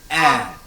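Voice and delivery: A as a low, open "eh"; French è sound. Shown as a demonstration. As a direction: demonstrate A as a falling open "eh". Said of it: "Then we pronounce the E".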